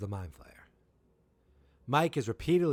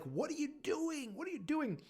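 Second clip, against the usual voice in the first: very high, softer falsetto; mimicked frantic Joyce; 5 dB quieter than usual. Joyce questioning Hopper after the shooting, frantic falsetto mimicry. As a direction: squeak the question in mock alarm.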